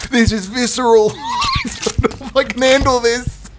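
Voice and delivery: breaking into laughter; laughing. Real, not acted. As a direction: laugh through the line. Laughing mid-scene.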